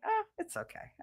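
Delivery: high, soft, syrupy reassurance; sugary sweet. Retold or imitated. Wife sweetly forgives staring clerk, high and soft. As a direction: coo it to him, way up and soft.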